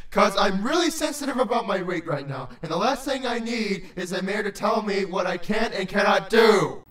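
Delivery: low, indignant rant; offended creature. A narrator's reading. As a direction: rant touchily in a deep voice, building to a shout.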